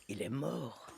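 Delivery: a hushed deadpan line; flat quiet reply. Retold or imitated. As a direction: drop it low and blunt. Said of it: "Elle me dit".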